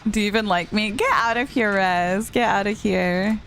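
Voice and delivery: ordinary talk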